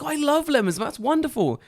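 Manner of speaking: high-pitched, gushing child imitation; little girl's voice. Little girl delighted by the lemon tree, high gushing voice. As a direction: enthuse like a small kid. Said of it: "She's like".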